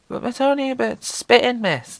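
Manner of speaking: put-on high, light child voice; child's protest. Pupil protesting to the teacher about the rain, high and childlike. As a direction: plead like a kid to the teacher. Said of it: "child answers teacher".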